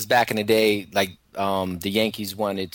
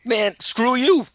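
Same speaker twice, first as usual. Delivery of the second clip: New York accent; 12 semitones higher, 6 dB louder, slower than usual. Real, not acted. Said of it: "I like to hear that New York accent".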